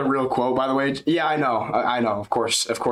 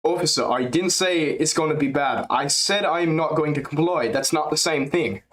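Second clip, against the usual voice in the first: put-on higher voice, firmer light Dutch accent; better DDP impression; 4 semitones higher than usual. Streamer nailing DDP impression, light Dutch-tinged accent. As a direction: deliver it like a stubborn Dutch fighter. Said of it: "That's actually good".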